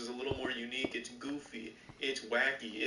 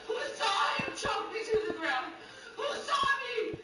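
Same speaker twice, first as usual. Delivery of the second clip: angry shouting. Acted. Clip of furious woman yelling.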